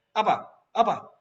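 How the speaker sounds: loud, high challenge; retold quarrel. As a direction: snap back as in an argument, acting it out.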